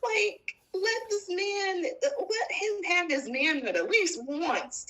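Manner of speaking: high, drawn-out exasperation; exasperated. Real, not acted. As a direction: plead with Steffi in disbelief. Exasperated rant about Steffi.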